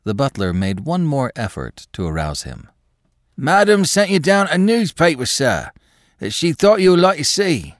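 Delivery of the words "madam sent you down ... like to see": high, loud shout into a dazed ear. A narrator's reading. Butler rousing his dazed master, high and loud. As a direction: raise the voice high to rouse him. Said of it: "He shouted almost into his master's ear".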